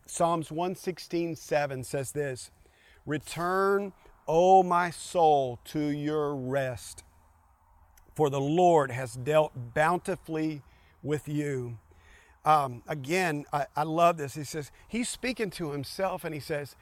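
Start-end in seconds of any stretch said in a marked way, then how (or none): 3.0-11.8 slow solemn reading. Shown as a demonstration. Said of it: "slow scripture reading".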